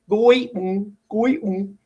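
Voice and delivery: GOI-ING said as "goy", then a bare hummed "ng"; weird syllable split. Shown as a demonstration. Teacher showing students what sounds weird, GOI-ING wrongly split. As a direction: break GOI-ING after oy with no vowel in the ending. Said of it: "that sounds weird".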